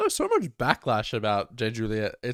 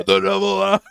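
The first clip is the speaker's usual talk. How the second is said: high, loud meme shout; shouted catchphrase; 8 semitones higher, 10 dB louder than usual. Acted. Co-host yelling the voice actor's meme catchphrase, loud high shout. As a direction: belt it out like a hype chant.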